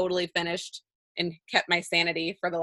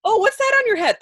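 much higher and louder, a mock nosy stranger; curious onlooker; 13 semitones higher, 7 dB louder than usual. Pretend stranger asks dad about the pin, high mock curiosity. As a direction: play a passerby spotting the hat. Acting a stranger noticing the hat.

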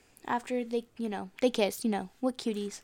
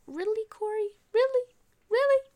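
high-pitched, rising disbelief; incredulous scolding; 10 semitones higher than usual. Pretend. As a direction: question him in ever higher mock disbelief. Teller mockingly challenges Corey.

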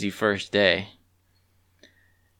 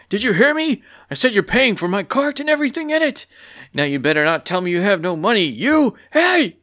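higher, louder scolding farmer; angry farmer; 11 semitones higher, 5 dB louder than usual. Acted. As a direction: demand the money, building to a shout. Furious farmer demands payment.

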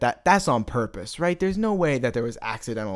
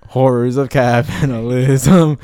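announcer intro; 11 dB louder than usual. Acted. Podcast intro title call.

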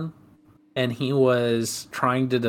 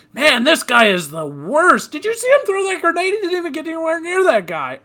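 high, fast mocking gamer voice; mock trash talker; 13 semitones higher than usual. Teen gamers mocking a bad teammate, high and fast.

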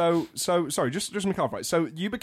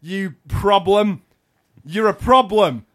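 loud, higher, halting accusation; mock confrontation; 4 semitones higher, 8 dB louder than usual. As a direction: play a loud troublemaker picking a fight. Acted confrontation, calling someone a problem.

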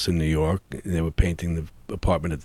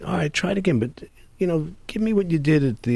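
lightly voiced director, pitched up; a hint of the director, higher and brisk; quoting the director; 11 semitones higher than usual. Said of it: "David Simon says".

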